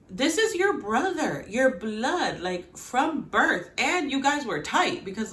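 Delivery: high, heated insistence; worked-up rant. Real, not acted. Fan ranting about the brothers, high. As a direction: drive home the brother bond.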